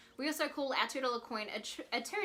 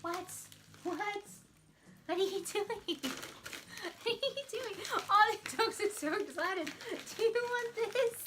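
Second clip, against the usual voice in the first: cooing pet voice, higher and light; high dog-talk voice; 10 semitones higher than usual. Real, not acted. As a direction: coo at the excited dogs, pitched way up. Talking to excited dogs.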